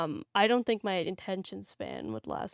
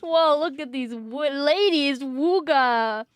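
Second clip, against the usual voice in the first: mock horny guy; 4 semitones higher, 4 dB louder, much slower than usual. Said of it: "I'm like".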